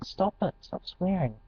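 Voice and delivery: put-on a soft, high plea from a teen girl; girl telling them off. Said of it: "this girl's going".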